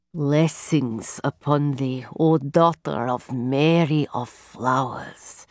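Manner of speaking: low, slow, solemn blessing. Acted. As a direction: bless her like a seeress, slowly.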